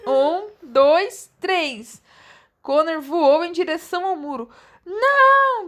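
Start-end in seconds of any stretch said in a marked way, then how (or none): 4.9-5.7 high, loud scream of no; yelled no. A narrator's reading. Sister sees Connor leap, loud horrified scream. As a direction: scream it out in alarm. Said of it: "berrou Alex".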